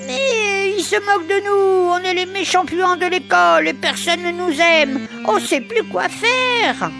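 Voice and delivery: high, whining, tearful plea; mock-tearful high complaint from a little outcast; plaintive pleading. Little outcasts plead with old rat.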